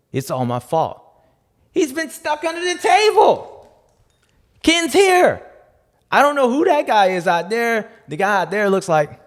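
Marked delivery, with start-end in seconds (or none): 1.7-7.9 squeaky high excited discovery. Pretend. Showing fans the dummy found under the table, high and excited. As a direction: gush it out high and loud like a thrilled find.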